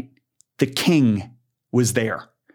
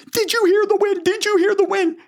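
screaming. Retold or imitated. Singer screaming at him in the car. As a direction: shriek it like she can't believe it. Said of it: "she literally screams".

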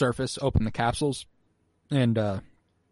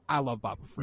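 quieter echo; 9 dB quieter than usual. Shown as a demonstration. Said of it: "Just kidding".

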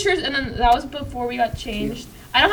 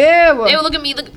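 loud disgusted ew; grossed out; 3 semitones higher, 5 dB louder than usual. Real, not acted. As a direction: recoil at the photo. Disgust at old photo.

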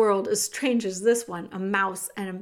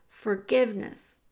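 usual speaking voice

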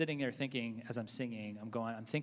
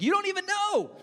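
hammed-up shriek, much higher and louder; mock yell; 10 dB louder than usual. Pretend laboring mother yelling back at a childless host. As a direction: squeal it like an outraged mother mid-push.

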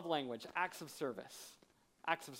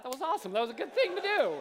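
much higher and louder, thrilled; excited cheer; 9 semitones higher, 6 dB louder, faster than usual. Real, not acted. Pastor joins the congregation's cheer, loud and high. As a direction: cheer it out to the crowd.

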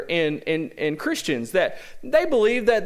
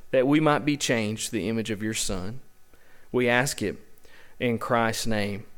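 prayer; 7 semitones lower than usual. Acted. Pastor prays before the sermon.

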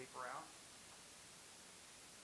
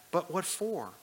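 put-on higher, louder puzzled boy; boy Shasta, puzzled; 7 semitones higher, 9 dB louder, slower than usual. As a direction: demand an explanation. Shasta asks the voice.

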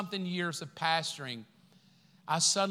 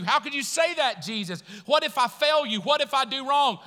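higher, louder pleading questions; anxious believer; 5 semitones higher, 6 dB louder than usual. Retold or imitated. Believer asking Jesus, louder anxious pleading.

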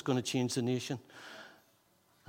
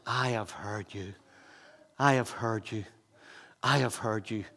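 voice of God; 4 semitones lower, 5 dB quieter than usual. Acted. God answering the repentant young king, low and soft. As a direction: speak as God, gently answering. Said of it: "God says".

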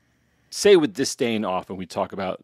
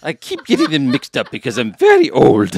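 put-on loud, higher-pitched doddery old royal; badly faked British queen voice; Queen Elizabeth; 4 semitones higher, 7 dB louder than usual. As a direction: play a muddled monarch, hammed up and near laughing. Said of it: "impression of the dead Queen Elizabeth".

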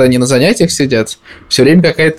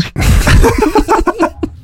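high laugh. Real, not acted. Laughs at own joke.

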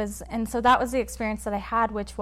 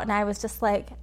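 ordinary talk, nothing marked

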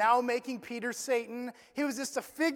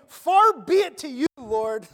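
bold rebuke; 8 dB louder than usual. Retold or imitated.

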